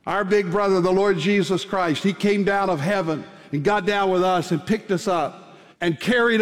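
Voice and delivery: rising, fervent preacher's build; sermon climax over music; preaching. Acted. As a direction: lift the congregation with hope.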